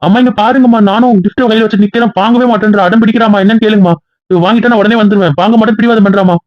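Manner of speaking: high-pitched call to mother, complaining. Acted. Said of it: "டேய், ஏங்க இப்படி கத்துறீங்க".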